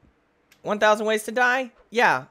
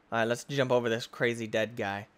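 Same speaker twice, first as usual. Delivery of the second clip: usual speaking voice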